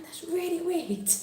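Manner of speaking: high, hushed, drawn-out remark; puzzled aside. Retold or imitated. Mum puzzled by her children in the shop, hushed aside.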